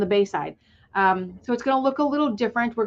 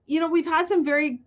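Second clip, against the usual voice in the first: words spilling out through a laugh, pitched up; 7 semitones higher than usual. Real, not acted. Guest still chuckling at the accent joke as she resumes, laughing. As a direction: keep laughing as you start talking again.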